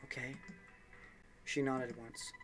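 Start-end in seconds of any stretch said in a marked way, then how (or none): 0.0-0.4 reassuring. Acted. Junpei comforts Jun.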